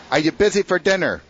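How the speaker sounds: put-on Irish pastor inviting the new priest to dinner, Irish accent. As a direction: ask it like a hearty Irish priest. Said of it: "It's an Irish accent".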